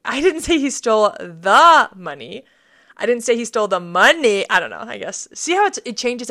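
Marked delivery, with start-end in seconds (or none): 0.1-2.4 "the" drawn out and loud; exaggerated emphasis on "the"; stress on THE. Shown as a demonstration. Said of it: "read it seven different ways". 3.0-4.5 "money" punched loud at the end; heavy emphasis on the last word, "money"; stress on MONEY. Shown as a demonstration. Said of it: "read it seven different ways".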